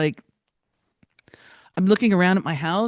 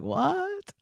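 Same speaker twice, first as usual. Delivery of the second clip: much higher, a sharp disbelieving yelp; jolted by the bad news; high, incredulous what; shocked what; 4 dB quieter than usual.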